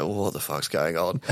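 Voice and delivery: low, soft groggy mumble; bewildered murmur, barely awake; hungover bafflement. Retold or imitated. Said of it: "wakes up with a splitting hangover".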